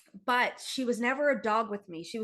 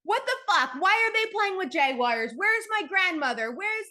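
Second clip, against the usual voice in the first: high, loud, frantic questioning; lost in a terrifying vision, demanding answers; panicked disbelief; 9 semitones higher, 4 dB louder than usual. Retold or imitated. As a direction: cry out in confused alarm. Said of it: "I'm going".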